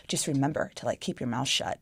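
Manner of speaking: mock boyfriend's warning, low and soft. Boyfriend warning her before a family function, low warning. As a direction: retell a hushed-down warning under your breath.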